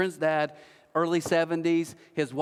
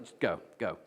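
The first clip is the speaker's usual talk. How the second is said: low, soft, unbothered brush-off; calm dismissal; 9 semitones lower, 6 dB quieter than usual. Retold or imitated. Jesus waving off the critics, low and soft. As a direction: brush them off quietly. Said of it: "Jesus is like".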